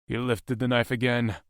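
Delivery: usual speaking voice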